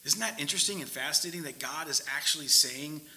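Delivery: normal talking voice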